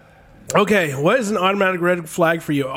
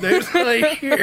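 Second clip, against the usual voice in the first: slow, high giggly echo; laughing; 8 semitones higher, slower than usual. Retold or imitated. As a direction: laugh the words out slowly. Repeating friend's quote laughing.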